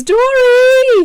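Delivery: high, loud shout with a drawn-out end; excited call-out. Retold or imitated. Reading a viewer's chat message aloud, excited call-out. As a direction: shout the name out high and long. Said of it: "an enemy says".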